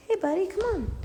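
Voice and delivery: high coaxing call to a pet; calling the dog. Real, not acted. Owner calls her puppy up the stairs, high coaxing voice. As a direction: coax the puppy up, pitch way up.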